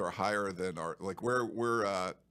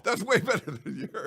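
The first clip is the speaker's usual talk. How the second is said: laughing it out, higher and louder; 11 semitones higher, 5 dB louder than usual. Real, not acted. Boasting to the co-host while laughing hard. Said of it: "Dude, that was horrible".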